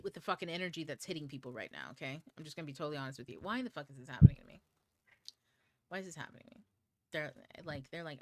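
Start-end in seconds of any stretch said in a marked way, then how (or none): 3.4-4.6 loud, fast frustrated cry to the sky; exasperated outburst. Retold or imitated. Venting to viewers about the chaotic energy, a loud exasperated outburst. 5.9-6.6 the same complaint dropped to a much softer, deflated murmur; quiet repeat. Retold or imitated. Repeating the complaint to viewers, soft and deflated.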